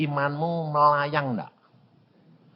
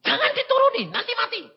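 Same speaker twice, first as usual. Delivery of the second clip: much higher, frantic pleading; panicked grandparent; faster than usual. Retold or imitated. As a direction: plead in alarm like a scared grandparent. (nada tinggi panik)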